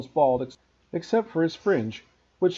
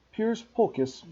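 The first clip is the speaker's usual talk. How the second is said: ordinary delivery, nothing marked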